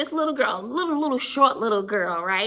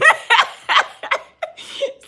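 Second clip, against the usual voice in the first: loud, high cackling laugh; helpless high laugh at yourself; laughing hard; 5 dB louder than usual. Real, not acted. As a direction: burst out in real laughter. Loses it laughing mid-story.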